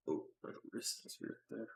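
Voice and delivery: normal talking voice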